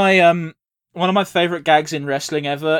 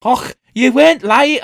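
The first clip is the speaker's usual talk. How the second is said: slow, high attempt at a Scottish accent; slow, hammy Scottish brogue; 6 semitones higher, 4 dB louder, slower than usual. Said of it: "I can't do a Scottish accent".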